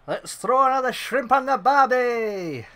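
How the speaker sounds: high, drawn-out, bad Australian accent; hammed-up Australian catchphrase voice; terrible Australian accent. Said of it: "that was a terrible Australian accent".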